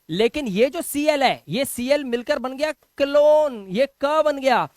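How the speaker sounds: usual speaking voice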